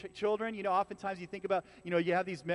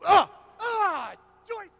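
mock pained yelp; 13 semitones higher, 10 dB louder, slower than usual. Stubbing toe, forced joy.

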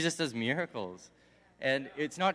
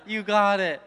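higher and building louder mock cheer; excited crowd; 8 semitones higher, 7 dB louder, slower than usual. The crowd cheering the beggar toward Jesus, mock excited. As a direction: hype him up like an eager crowd. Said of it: "they're all like".

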